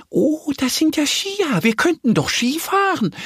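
very high, eager little duck voice; very excited. A narrator's reading. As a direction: burst out with a bright idea as the duck. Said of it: "sagt Annie ganz aufgeregt". (ganz aufgeregt, hohe Entenstimme)

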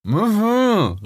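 high muffled hum of assent; muffled, high "mhm"; mouth full. Acted. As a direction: answer with a stuffed mouth. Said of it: "rief Rix mit vollem Maul".

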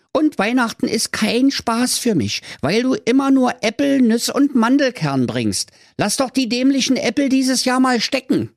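put-on squeaky kid voice wailing at Santa; whiny little boy. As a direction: beg Santa like a bullied kid. Timmy pleads with Santa.

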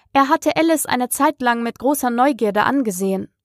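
ordinary delivery

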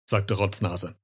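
usual speaking voice

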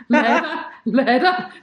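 laughing through the words. Real, not acted. Laughing at the joke about the duo. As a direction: laugh it out, amused.

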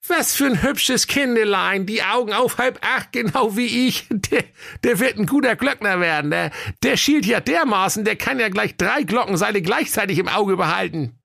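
a caught sneak stammering his way out; sexton's voice. Acted. Sexton caught in the wardrobe, high and flustered.

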